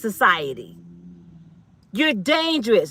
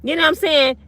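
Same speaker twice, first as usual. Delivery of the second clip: usual speaking voice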